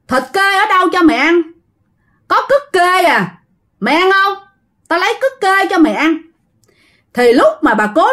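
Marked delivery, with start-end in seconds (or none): 0.1-6.2 high-pitched, exasperated retort; irritated mother. Acted. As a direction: scold the spirit, thoroughly fed up. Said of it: "bà Cố mới bực mình lên".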